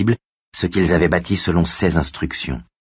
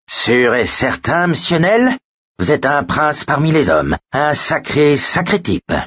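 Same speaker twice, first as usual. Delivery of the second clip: high, hammed-up orator's voice; Franklin Roosevelt parody; 9 semitones higher than usual. A narrator's reading. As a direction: do a woefully bad statesman impression. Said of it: "une parodie lamentable de Franklin Roosevelt". (parodie lamentable, aiguë et pompeuse)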